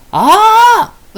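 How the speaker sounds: a loud, high "aah" of realization; loud drawn-out ah. Acted. Fairy realizing aloud despite being hushed, loud ah. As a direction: shout the discovery out. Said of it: "大きな声出しな言うてるねんな".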